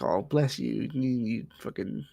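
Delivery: soft, slow, sugary "oh bless you"; sweet tone over a nasty word; mock sweet insult. Shown as a demonstration. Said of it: "so condescending".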